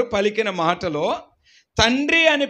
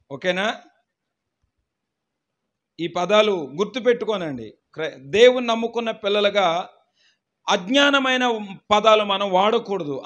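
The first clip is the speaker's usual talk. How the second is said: ordinary talk, nothing marked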